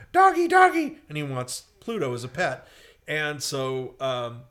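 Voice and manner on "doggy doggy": high, loud cartoon brat squeal; spoilt pig kid. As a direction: squeal greedily for the dog in falsetto. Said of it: "He looks out the window and he goes".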